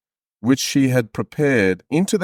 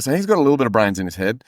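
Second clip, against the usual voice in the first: ordinary talk, nothing marked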